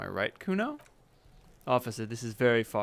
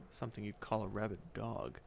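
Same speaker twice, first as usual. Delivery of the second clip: ordinary delivery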